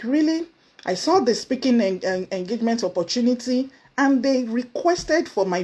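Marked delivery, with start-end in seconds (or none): 0.0-0.5 a high, doubtful "Really?"; half-believing the praise, ask it high; surprised disbelief. Retold or imitated. Telling how she reacted to fans' praise, surprised. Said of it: "And I was like".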